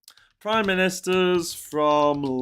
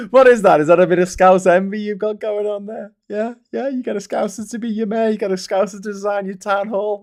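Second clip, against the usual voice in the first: higher, playful gloating jibes; teasing mockery; 7 semitones higher, 6 dB louder than usual. Real, not acted. Host teases Mancunians over Scouse envy, higher and playful. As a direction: needle the rivals with a grin. Mocking Manchester rivalry.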